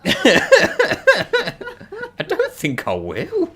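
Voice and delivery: squealed high then slow; laughing refusal. Real, not acted. Reacting to teasing question.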